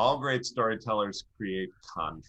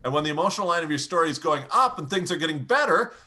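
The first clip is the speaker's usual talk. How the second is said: louder and much higher as things improve; rising volume; 9 semitones higher, 4 dB louder than usual. Shown as a demonstration. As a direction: build it up with energy. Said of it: "A second tool is your volume".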